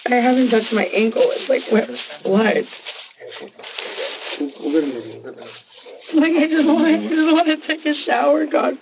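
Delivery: high-pitched, agitated distress; real distress, pitch climbing high in exasperation. Real, not acted. Suspect upset by blood on her.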